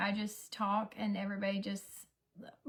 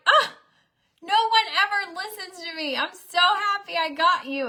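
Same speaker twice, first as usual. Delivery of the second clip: high, loud delighted squeal; gleeful squeal; 9 semitones higher, 11 dB louder than usual. Retold or imitated. Mom squealing to daughter that she finally listened, high and loud. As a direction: squeal with glee at being heard. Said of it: "And I was like".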